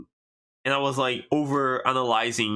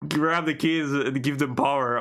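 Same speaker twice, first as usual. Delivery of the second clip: ordinary talk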